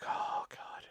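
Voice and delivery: whispered groan under the breath; exasperated whisper. Retold or imitated. Clerk whispering despair away from the caller. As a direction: hush it so the caller won't hear.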